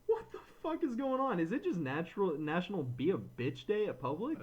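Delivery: high, incredulous whoa; shocked outburst. Retold or imitated. Retelling his reaction to the rude lady, mock outrage. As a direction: pitch way up in disbelief at her rudeness. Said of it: "I was like".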